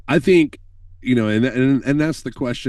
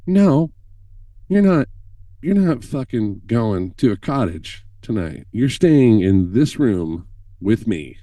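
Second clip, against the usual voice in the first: insistent scared plea; 3 dB louder, slower than usual. Retold or imitated. Scared Chad to host: loud, insistent refusal. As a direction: refuse to be left alone, emphatic.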